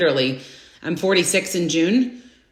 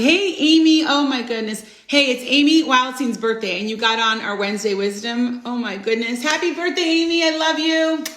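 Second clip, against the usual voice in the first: excited birthday greeting; 5 semitones higher than usual. Real, not acted. Greeting friend on her birthday.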